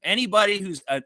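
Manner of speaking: ordinary talk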